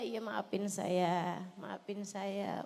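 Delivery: acted meek apology, slow and low; softly acted, submissive sorry. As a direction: give in softly, stretching out the plea. Models meek apology to husband.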